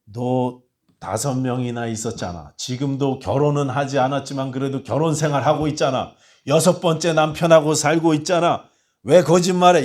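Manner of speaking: building from firm to loud rebuke; mock scolding. Jesus imagined scolding the woman, building rebuke. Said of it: "이렇게 야단치시는 것이 아니고".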